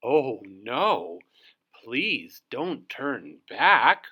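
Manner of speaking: a sly fox pleading sweetly; drawn-out wheedling fox; Foxy Loxy's voice. Acted. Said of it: "said Foxy Loxy".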